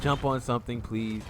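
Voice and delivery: ordinary talk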